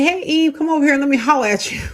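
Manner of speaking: high, fast, casual holler as God imagined saying it; playful call. Retold or imitated. Mock God calling out to Eve, casual, high and fast. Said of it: "God didn't say".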